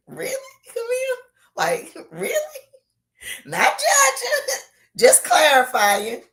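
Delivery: high playful teasing. Real, not acted. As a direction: tease her with a laugh. Teasing a viewer's comment.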